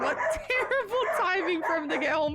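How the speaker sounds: real flustered laughter; laughing apology. Real, not acted. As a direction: giggle out the apology. Laughing over barking chaos.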